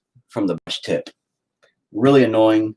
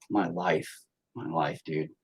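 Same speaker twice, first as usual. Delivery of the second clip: quiet, exhausted sigh of a phrase; weary mutter; 5 semitones lower, 13 dB quieter, slower than usual. Real, not acted. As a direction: mutter it, worn out. Weary sigh about his life.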